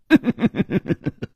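high falling laugh; short laugh cut off; grim laugh. A narrator's reading. Character laughs before stopping.

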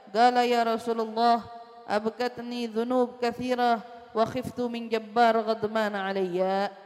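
loud, measured Arabic reading; solemn recitation of the Arabic text; hadith recitation. Acted. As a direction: read the hadith out with formal cadence.

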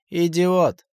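loud, high angry outburst. A narrator's reading. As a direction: snap it out in anger. Boss snaps at nosy question.

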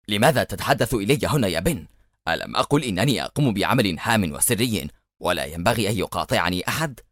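usual speaking voice

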